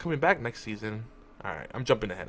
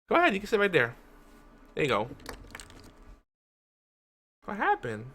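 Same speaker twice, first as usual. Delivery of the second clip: higher, soft coaxing voice; lightly raised pet voice; gentle pet talk; 8 semitones higher, 3 dB quieter, faster than usual. Real, not acted. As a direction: coax the cat to sit. Settling the cat on the desk.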